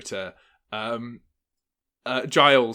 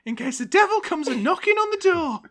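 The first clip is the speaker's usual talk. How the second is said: put-on high old wife's voice, rising to a loud warning; old woman voice; 5 dB louder than usual. Cecily warning about the devil at the door, high old woman's voice. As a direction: speak like a fearful old wife, then cry out. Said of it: "I say".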